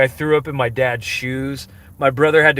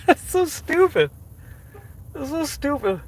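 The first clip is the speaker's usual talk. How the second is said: high laughing squeal; 13 semitones higher, 4 dB quieter than usual. Real, not acted. Laughing at their own old drink.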